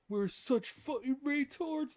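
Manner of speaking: high, drawn-out mock realization; stretched, high-pitched imitation of amused dismay; retold laughing disbelief. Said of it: "Like, you started laughing".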